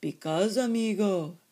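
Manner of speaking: biggest little dog. A narrator's reading. Biggest dog explains to kitten, weighty and loud. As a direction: explain with weight, like the gang's leader. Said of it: "the biggest of the small ones".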